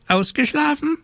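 very high squawked question; perky raven. Acted. Raven on the bedpost to waking witch, high squawk. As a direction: chirp it as a nosy bird.

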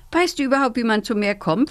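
ordinary delivery

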